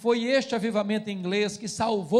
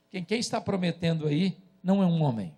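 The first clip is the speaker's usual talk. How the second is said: ordinary delivery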